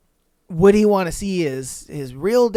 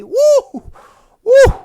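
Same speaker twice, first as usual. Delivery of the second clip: two very high, loud woos; shocked whoops; 8 dB louder than usual. Real, not acted. Streamer whooping at a wild twist, very high and loud. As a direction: whoop in stunned disbelief.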